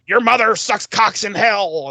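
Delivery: quoting the Exorcist demon. Retold or imitated. Possessed son quoting The Exorcist, lightly voiced. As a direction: taunt it like a possessed boy. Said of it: "he's all like".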